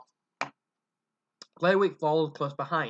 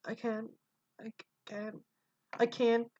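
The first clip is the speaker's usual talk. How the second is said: faint protest; 6 semitones higher, 7 dB quieter than usual. Acted. Son's feeble protest.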